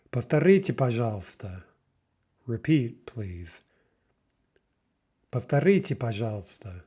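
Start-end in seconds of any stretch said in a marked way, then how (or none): none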